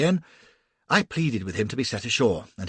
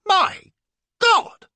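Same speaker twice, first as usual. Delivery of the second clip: shocked shout, high and loud; sudden horrified cry; 9 dB louder than usual. A narrator's reading. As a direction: blurt it out in horror. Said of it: "cries I".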